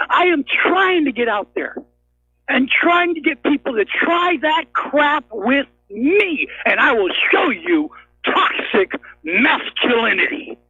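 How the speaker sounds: slow, emphatic challenge that starts high; real angry challenge, drawn out for force; combative rant. Real, not acted. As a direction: dare them, hammering word by word. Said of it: "because I talk like this".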